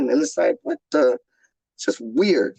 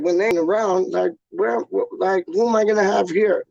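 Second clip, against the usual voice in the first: ordinary delivery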